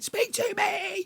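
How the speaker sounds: very high, slow, strained plea; squeaky mock-desperate cry; desperate pleading. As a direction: beg like a desperate chaser, stretched out. Mock chasing customer up arcade.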